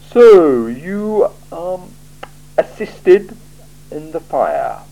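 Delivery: a cool ruler weighing every word; icy, deliberate interrogator; Lord Vetinari. Acted. Vetinari questions Vimes.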